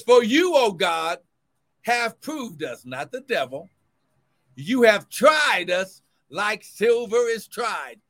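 rising, ringing preacher's reading, slowed on the mark; preaching scripture. Acted. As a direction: proclaim the verse to the congregation. Preacher reads verse.

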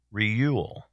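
Reuel said as "REE-yool"; mispronounced Reuel. Real, not acted. Host guessing at a trivia answer's name, Reuel mispronounced as "REE-yool". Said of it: "So I was pronouncing it wrong".

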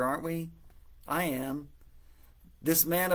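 ordinary talk, nothing marked

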